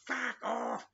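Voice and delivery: high-pitched shouted comeback; yelled retort. Retold or imitated. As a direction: yell it back up, re-enacting the row. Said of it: "And I'd go".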